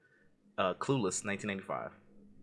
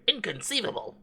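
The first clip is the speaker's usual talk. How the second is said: much higher put-on lisping movie-villain voice; film character voice; 11 semitones higher than usual. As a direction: exclaim it like the indignant little schemer. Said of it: "I tried to do the voice".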